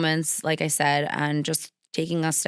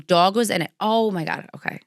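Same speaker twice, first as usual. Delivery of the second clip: ordinary talk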